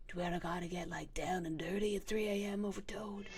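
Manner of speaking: low, hushed tease; mock-sultry murmur. Asking Toad about getting down and dirty, mock-sultry murmur. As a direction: drop low and soft, playfully.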